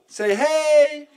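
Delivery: high, drawn-out call to people in another room. Real, not acted. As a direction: call down to an audience elsewhere. Calling to people in room below.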